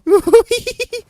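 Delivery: shrieking nervous laugh; panicked high laugh. Real, not acted. Laugh while fleeing.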